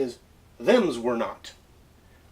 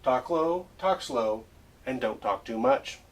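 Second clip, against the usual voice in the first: ordinary talk, nothing marked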